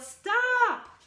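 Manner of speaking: a big, high, loud shout; yelled stop. A narrator's reading. Parents stop Ada's stinky experiment, loud yell. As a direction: cry out to halt her at once. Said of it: "but her parents yelled".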